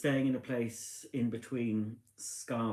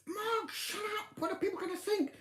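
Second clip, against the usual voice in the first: much higher scolding voice; shrill-pitched mock scolding of husband; telling off; 3 dB quieter than usual. Wife retelling how she scolded Mark, pitched high.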